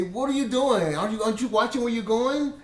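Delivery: a hammed-up furious rant at the wife; imagined angry yell. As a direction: let rip the scolding you held back. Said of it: "my flesh wanted to just scream at her".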